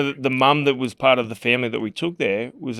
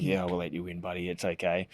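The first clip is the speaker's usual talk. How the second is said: much softer, patronising murmur; mock-soothing; 6 semitones lower, 12 dB quieter than usual. To his rival before the rematch, soft mock-soothing. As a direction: coo it as if humouring him. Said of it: "I'm like".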